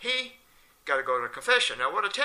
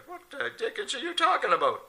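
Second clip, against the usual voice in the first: baffled rabbi; 11 semitones higher than usual. Retold or imitated.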